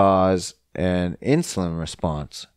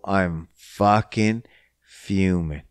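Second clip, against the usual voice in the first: ordinary talk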